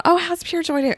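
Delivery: high, chirpy imitation of someone asking; imagined friend asking. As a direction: lightly act out a curious acquaintance.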